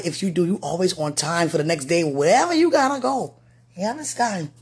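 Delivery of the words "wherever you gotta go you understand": usual speaking voice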